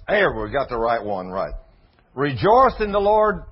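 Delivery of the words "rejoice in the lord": slow, loud preaching of the verse; preached scripture. Acted. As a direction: proclaim the verse, word by word. Reads scripture loudly.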